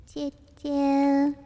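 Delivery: loud high choo choo; loud train whistle. Pretend.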